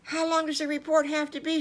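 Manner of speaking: put-on high, fast little-kid voice; quick squeaky question from a little pig; eager child. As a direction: pipe it up like an excited small pig. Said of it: "said Squiggly".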